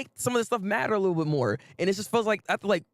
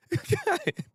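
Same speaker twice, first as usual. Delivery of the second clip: laugh; 14 semitones higher than usual. Real, not acted. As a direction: giggle at yourself. Laughing at own complaint.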